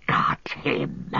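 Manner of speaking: hissed gloating creature voice; Gollum. A narrator's reading. Gollum seizing Sam from behind, gloating hiss. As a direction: hiss it low, right into his ear. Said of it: "hissed Gollum in his ear".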